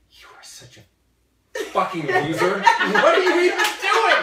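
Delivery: loud, furious mock rant; mock angry yelling. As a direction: yell it like an outraged friend. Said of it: "I just go".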